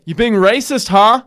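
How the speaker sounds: high-pitched accusing question; hammed-up confrontation; mock challenge. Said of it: "I think I was like".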